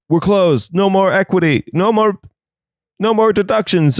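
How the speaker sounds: a hint of a prim clerk turning people away; mock bank clerk. Bank staff shutting the gates.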